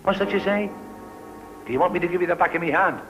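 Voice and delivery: high, loud, rapid scolding; angry threat. Acted. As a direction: snap loudly at a cheeky child. Threatening a cheeky child.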